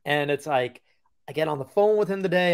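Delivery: ordinary talk, nothing marked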